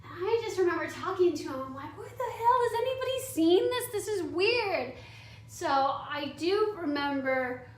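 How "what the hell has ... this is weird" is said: high-pitched, retold disbelief; acted-out incredulous outburst. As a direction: appeal to any witness in disbelief. Retold disbelief at his unwanted touch.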